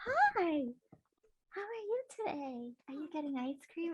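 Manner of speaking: high, soft storybook fairy greeting; sweet fairy voice. Retold or imitated. Fairy cosplayer to little girl, high soft sweet greeting. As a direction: coo at the child like a gentle fairy. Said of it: "I'm like".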